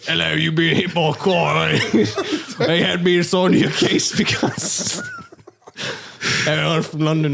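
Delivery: hammed-up London doctor; London accent. Doctor greeting the patient, broad London accent. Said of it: "it's the same accent".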